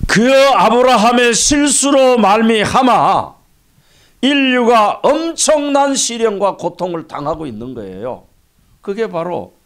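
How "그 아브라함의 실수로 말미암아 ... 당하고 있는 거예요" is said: loud, high pulpit cry; forceful preaching. Real, not acted. Pastor lamenting the fallout of Abraham's mistake, forceful preaching. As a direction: raise the voice high and drive every phrase home.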